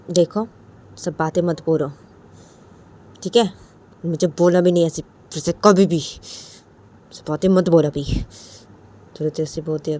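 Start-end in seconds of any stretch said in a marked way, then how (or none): 0.1-8.0 put-on loud, scolding girlfriend voice; stern girlfriend. Imitating the girlfriend telling him off, loud and stern. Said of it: "फिर तो बोलती है".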